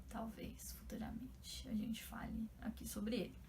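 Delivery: soft whispered teaser about a future video; whispered aside. Real, not acted. To her viewers, hinting at a future video, whispered. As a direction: drop to a whisper, like sharing a secret.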